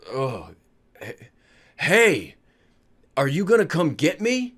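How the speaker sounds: slow, halting, lightly voiced woozy father; waking up with a rising, puzzled question; groggy waking dad. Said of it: "my father does wake up".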